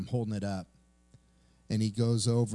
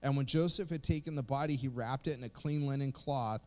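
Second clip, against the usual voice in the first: steady, slightly raised Bible reading voice; scripture reading; 4 semitones higher than usual. Acted. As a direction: read the passage with solemn weight. Reads Matthew passage aloud.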